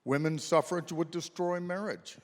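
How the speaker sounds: ordinary talk, nothing marked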